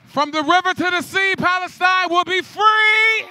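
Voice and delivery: high, loud host holler with stretched last words; crowd-rallying shout. Real, not acted. Slam host rallying the crowd after a poem, shouting. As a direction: whip up the whole room.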